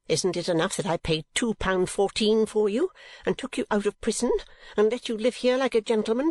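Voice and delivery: high-pitched wronged-wife tirade; screamed scolding. A narrator's reading. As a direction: berate the husband, pitch easing towards the end. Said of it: "screamed the woman".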